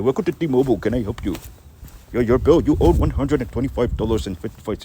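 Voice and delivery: high, loud mock East Indian accent. Foreign call-centre worker picking up the phone, mock East Indian accent. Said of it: "You do the accent".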